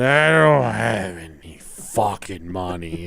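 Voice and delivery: put-on low, dragged-out drunken slur; drunk brother. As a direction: imitate a wasted brother slowly confessing. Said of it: "getting fucking hammered every night".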